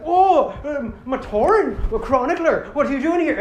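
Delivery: high, loud mock Irish accent. Le-Matoran greets the chronicler, loud Irish accent. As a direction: exclaim in a hammed-up Irish lilt. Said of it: "Irish accents for you guys".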